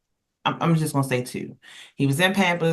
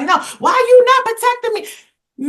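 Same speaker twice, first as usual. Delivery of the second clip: much higher, louder whiny demand; indignant woman; 5 dB louder than usual. Retold or imitated. Retelling the woman scolding the man filming, high indignant. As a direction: play her as shrilly offended. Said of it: "She like".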